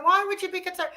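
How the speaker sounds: puzzled high-pitched why; surprised question. Retold or imitated. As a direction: play her own startled reply. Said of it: "I said".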